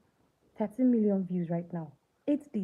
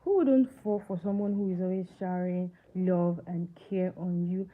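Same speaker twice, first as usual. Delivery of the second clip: ordinary talk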